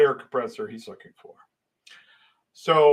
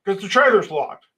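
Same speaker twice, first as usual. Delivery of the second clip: higher-pitched, halting protest; confused stammer; 6 semitones higher than usual. Retold or imitated. Worker explaining the locked trailer to foreman, confused. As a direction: imitate your baffled self explaining. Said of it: "I'm just sort of confused".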